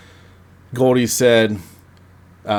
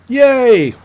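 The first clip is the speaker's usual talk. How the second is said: mock cheer; 10 dB louder than usual. Real, not acted. Cheering the movie's ending.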